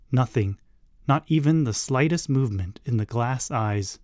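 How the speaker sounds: usual speaking voice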